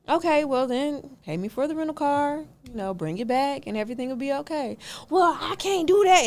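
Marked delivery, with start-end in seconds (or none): 5.1-6.2 mock refusal. Renter refuses, mimicked.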